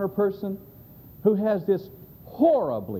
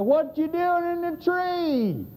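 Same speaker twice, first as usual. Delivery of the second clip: high, slow, stretched-out holler; calling out; 11 semitones higher, slower than usual. Retold or imitated. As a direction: imitate hollering across a yard. Man shouts from porch to tree.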